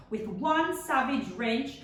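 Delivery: usual speaking voice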